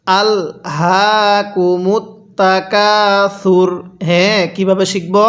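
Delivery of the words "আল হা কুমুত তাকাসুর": the whole verse drawn out slowly; slow demo recitation. Shown as a demonstration. Teacher recites the verse slowly for students to repeat. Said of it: "কিভাবে পড়ব?".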